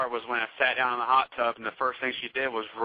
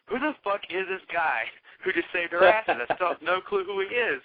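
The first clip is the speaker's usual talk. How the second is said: higher, baffled question; mock bafflement; 7 semitones higher than usual. The teller wondering who saved them, a higher mock-baffled question. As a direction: sound puzzled, pitched up. Quoting his own confusion.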